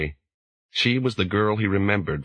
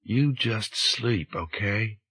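slow, drowsy soft Southern-tinged drawl; half asleep, coaxing him down gently; sleepy soft accent; 3 semitones higher, much slower than usual. A narrator's reading. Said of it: "Her soft accent was exaggerated with sleep".

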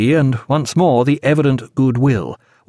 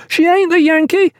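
very high, shrieked report; sustained high-pitched excited cry. A narrator's reading. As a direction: shriek the news across the deck. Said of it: "he shrieked to Pullings".

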